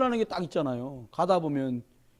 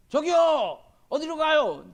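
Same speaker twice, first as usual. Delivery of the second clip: retold call out a car window, pitched higher; 8 semitones higher, 4 dB louder, much slower than usual. Driver calling to a passerby for directions, raised call. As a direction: hail a stranger for directions.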